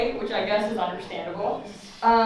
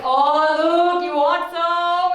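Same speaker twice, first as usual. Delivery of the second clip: high, slow teasing offer; a teasing dad, pitched up and slow; taunting dad; 6 semitones higher, 6 dB louder, much slower than usual. Retold or imitated. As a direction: dangle it in front of her, mocking. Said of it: "He's like".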